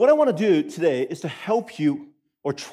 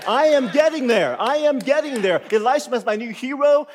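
loud, high mock-fan gush; mock enthusiasm; 7 semitones higher, 7 dB louder than usual. Mock fan of Elisha, playful excitement. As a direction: hype Elisha as a hero. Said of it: "one possible reaction is".

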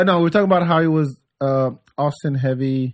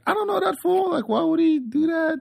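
very high-pitched imitated complaint; mock rant. Storyteller mimics the comedian ranting to him, high mock rant. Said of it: "she went off on this rant".

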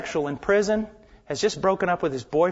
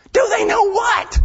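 high, loud indignant yell; boy in church yelling back at the preacher, much higher and louder; defensive shout; 8 dB louder than usual. Retold or imitated.

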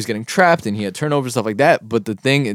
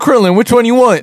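teasing; 10 semitones higher, 5 dB louder than usual. Real, not acted. Teasing jokes.

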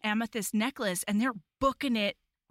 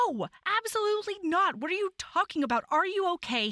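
alarmed refusal; 8 semitones higher, faster than usual. Retold or imitated. He refuses, worried for her.

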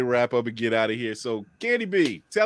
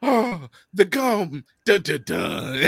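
very slow, drawn-out mock drama; mock dramatic reveal; 3 semitones higher, much slower than usual. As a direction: stretch it out like a movie twist. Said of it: "The fact that you say".

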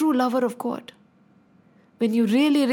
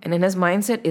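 usual speaking voice

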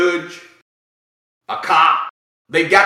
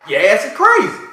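normal talking voice

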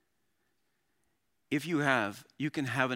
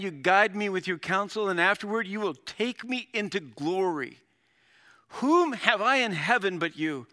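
raised, ringing scripture reading; passionate; 6 semitones higher, 6 dB louder than usual. Acted. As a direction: declare it to God with fervour, punching key words. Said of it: "I love the passion that he has".

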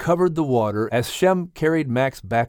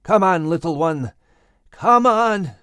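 calling out; 3 semitones higher than usual. A narrator's reading. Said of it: "Noah and family called to Max".

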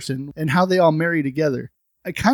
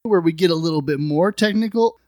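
ordinary delivery

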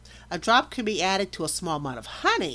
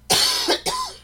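a loud, rough hacking cough; chesty cough; 7 dB louder than usual. Real, not acted. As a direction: hack it out from the chest. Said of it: "I'm so sorry for the coughing".

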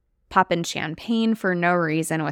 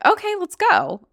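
eager reply; 13 semitones higher, 5 dB louder than usual. Retold or imitated. Boyfriend's eager reply retold.

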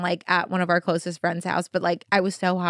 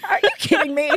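laughing disbelief; 5 semitones higher, faster than usual. Retold or imitated. Laughing incredulous friend.

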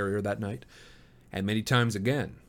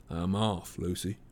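tender; 4 semitones lower, 4 dB quieter, slower than usual. A narrator's reading. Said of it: "gazing tenderly down upon her face".

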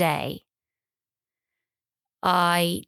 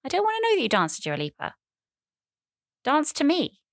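high, fast, hammed-up jealous whine; mock jealous plea; 10 semitones higher, faster than usual. To her partner who danced to [name], a high, fast mock-jealous whine. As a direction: play the wounded partner, pitched high and hurried. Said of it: "Got very jealous".

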